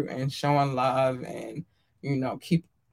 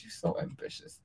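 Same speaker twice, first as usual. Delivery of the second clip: whispered; 2 semitones higher, 13 dB quieter than usual. Real, not acted. Fond aside to fans.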